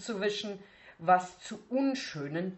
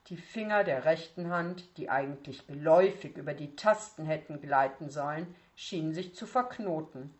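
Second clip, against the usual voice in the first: usual speaking voice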